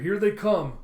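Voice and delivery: alarm. Retold or imitated. Pursuit alert in space.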